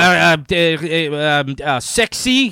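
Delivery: put-on halting Russian accent, groping for English words; thick Russian accent. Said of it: "like, a really thick accent".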